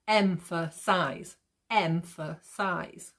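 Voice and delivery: "emphasize" drawn out slowly, leaning on em and size; stressed first and last syllables. Shown as a demonstration. Teacher showing students the beat, exaggerated syllable stress. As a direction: hit em and size in "emphasize", middle light. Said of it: "the M and the size are sounded more".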